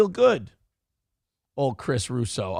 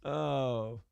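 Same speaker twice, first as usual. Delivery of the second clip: ordinary talk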